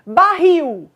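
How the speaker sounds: BARRIL announced high and loud, falling on RRIL; loud, high demo word BARRIL, weight on RRIL; stress on last syllable. Shown as a demonstration. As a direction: call out BARRIL with a strong final syllable. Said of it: "a sílaba mais forte é a última".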